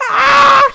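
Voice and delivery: short high loud shriek; imitated scream. Shown as a demonstration. Streamer showing the scream she imitates, loud shriek. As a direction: let out a sharp piercing scream. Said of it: "If ever I go like, "Ah!".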